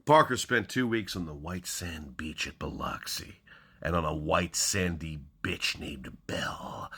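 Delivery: dramatic hardboiled crime reading; hammy stage actor. Acted. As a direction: punch each opening, then hush it. Reads crime novel openings dramatically.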